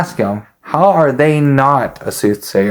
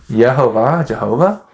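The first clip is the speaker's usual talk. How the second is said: Yehovah and Jehovah each posed as a question. Retold or imitated. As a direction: say "Yehovah, Jehovah?" as a tentative question, rising at the end. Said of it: "I would ask it as a question".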